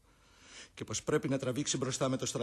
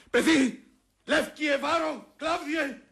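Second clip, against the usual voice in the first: much higher, much louder calls across the tent; shouting to wake; 9 dB louder, much slower than usual. Acted. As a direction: rouse the sleeping men. Brutus wakes his servants. (ο Βρούτος ξυπνά τους υπηρέτες με φωνές)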